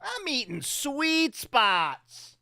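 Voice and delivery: slow, savoring gloat; slow gloating repeat. Pretend. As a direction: draw out the brag, savoring each word. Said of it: "I'm fucking eating Sweet Spots, you guys".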